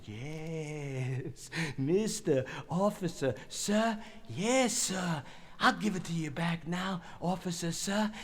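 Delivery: soft, drawn-out mock deference; hushed toying with him, polite on the surface; mock obedience. Mocking obedience while holding gun.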